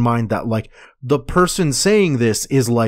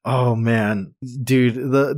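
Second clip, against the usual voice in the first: ordinary delivery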